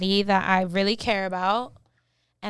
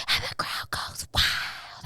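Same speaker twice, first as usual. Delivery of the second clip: whispered crowd; 5 dB quieter, slower than usual. Pretend. Fake crowd after the news.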